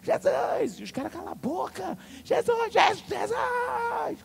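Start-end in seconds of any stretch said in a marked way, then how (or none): 0.0-0.8 high, loud cry for Jesus; shouting. Retold or imitated. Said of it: "Começou a gritar lá". 1.1-2.0 high-pitched order to shut up; hushing someone. Retold or imitated. Homens mandam a mulher calar. 2.2-4.2 repeated high, loud screams; shouting. Retold or imitated. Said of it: "Começou a gritar lá".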